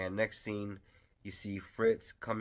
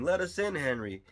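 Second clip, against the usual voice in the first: high, raised imitation of calling up; mock shouting; 8 semitones higher, 5 dB louder than usual. Visitors shouting up at Henry in the window, mock shouting. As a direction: act out a shouted plea to someone above. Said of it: "as they're shouting".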